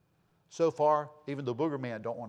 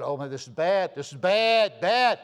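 mounting mock alarm, louder and higher; scared friend; 4 semitones higher, 7 dB louder, slower than usual. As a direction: imitate a scared friend getting more alarmed. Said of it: "He's scared".

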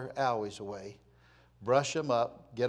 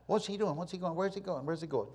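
hushed, higher voice of nosy watchers; murmuring onlookers; 4 semitones higher than usual. Acted. People at tent doors watch Moses, murmuring onlookers.

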